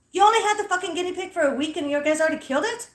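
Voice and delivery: fast, high-pitched disbelief; incredulous outburst. Retold or imitated. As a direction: blurt it out in acted shock. Acted incredulous reply to the mother.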